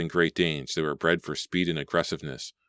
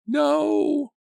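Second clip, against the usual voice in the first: very high, loud wail; 7 dB louder than usual. A narrator's reading. Claudia seeing the shark, very high loud wail. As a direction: wail in terror. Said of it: "Claudia's wail".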